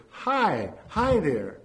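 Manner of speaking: high, falling little hello; baby's happy answer. A narrator's reading. Baby answers the waving old man, happy high hello. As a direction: answer brightly as a delighted baby. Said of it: "continued to laugh and answer".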